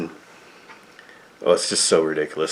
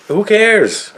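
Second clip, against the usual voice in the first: high, louder scoffing cry of disbelief; exasperated outburst; 13 semitones higher, 4 dB louder than usual. Real, not acted. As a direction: throw it away: who cares. Exasperated outburst about Bernie.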